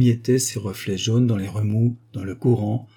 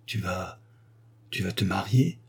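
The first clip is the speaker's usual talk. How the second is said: much softer, faltering question; stunned and hushed; 3 semitones lower, 5 dB quieter than usual. Acted. Woman learning her lover will marry another, stunned hush. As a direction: barely get the words out in shock. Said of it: "Elle eut un sursaut".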